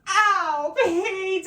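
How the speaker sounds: mock wail at the dog; owner's cry. Owner cries out at her pug after the accident, high and loud. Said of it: "she goes".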